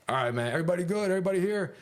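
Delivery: quick, high-pitched mock officer's check; imitated officer. Officer counting inmates in their cells, imitated high and brisk. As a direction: call it out like an officer on rounds.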